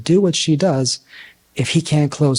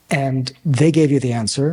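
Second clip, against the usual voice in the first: ordinary talk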